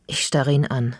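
ordinary delivery

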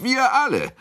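very high-pitched eager cry; shouting. A narrator's reading. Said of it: "schrie Kunicke".

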